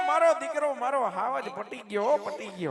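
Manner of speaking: soft, lowering cajoling; coaxing. Pretend. Uncle placating his sulking boy, coaxingly. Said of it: "મારા હાવજને પટાવું".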